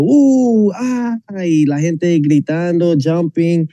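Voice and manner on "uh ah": high mock crowd whoop, then a fading ah; mimicked excited crowd cry; imitating crowd cheer. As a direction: whoop like fans in the arena. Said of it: "la gente de gritar diciendo".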